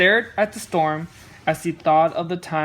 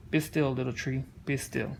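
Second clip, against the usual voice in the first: softer and lower, slow and calm; peaceful and slow; 5 semitones lower, 7 dB quieter than usual. Acted. Old tree soothing itself through the thunder, calm and soft. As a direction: let it settle like a hush. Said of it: "so peaceful and slow".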